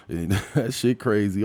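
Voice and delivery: ordinary delivery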